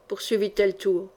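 ordinary delivery, nothing marked

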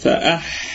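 "فأح" breathed out unvoiced, the حاء soft and airy; whispered hams. Shown as a demonstration. Teacher demonstrating the whispered, soft حاء in "فأح". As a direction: let the air run on the حاء of "فأح" with no voice. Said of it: "هذا هو الهمس مع الرخاوة".

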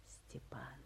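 hushed aside; whispered name. Acted. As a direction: whisper the name as if it were a secret. Drops name to a secret.

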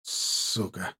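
soft hissed curse under breath. A narrator's reading. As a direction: hiss it low. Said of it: "прошипел я тихо".

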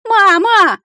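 put-on high, loud child's wail; piercing childish cry. A narrator's reading. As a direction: wail for mama like a little dragon. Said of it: "заголосил Кирюша".

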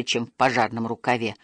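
plain reading voice

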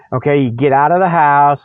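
ordinary talk

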